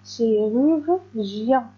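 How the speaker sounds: "Chirurgien" drawn out slowly for learners to copy; very slow, careful "Chirurgien"; slow model for repetition. Shown as a demonstration. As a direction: stretch "Chirurgien" out, chi-rur-gien. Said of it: "Répétez".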